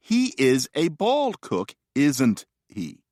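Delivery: slow, measured grammar example with a tag question; recited example sentence. Shown as a demonstration. Lecturer showing the pattern of tag questions, slow and measured. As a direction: recite it slowly, word by word.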